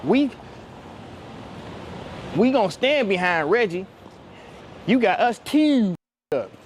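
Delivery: high-pitched imitation of Baltimore talk; Baltimore accent. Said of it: "I love y'all accent".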